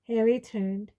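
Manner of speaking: ordinary delivery, nothing marked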